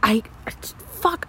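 shaky whisper. Acted. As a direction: breathe the words out. Unvoiced whisper.

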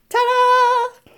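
high, loud ta-da; excited reveal. Acted. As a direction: present it with a flourish. Revealing the clearing.

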